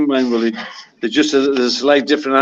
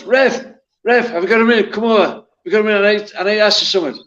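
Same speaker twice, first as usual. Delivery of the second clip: shouting to the ref; 8 semitones higher, faster than usual. Retold or imitated. Player calling the referee over from the touchline, shouting. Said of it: "So I shouts".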